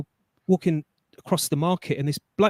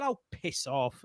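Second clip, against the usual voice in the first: slow, much softer, unbothered brush-off; calm dismissal; 4 semitones lower, 10 dB quieter, slower than usual. Retold or imitated. Man leaving the shop, dismissing the shouting manager: calm slow brush-off. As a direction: let it drop, cool and unimpressed. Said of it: "calm as anything".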